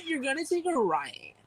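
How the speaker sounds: a hint of a ditzy girl giving directions; blonde girl voice. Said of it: "a blonde girl being like".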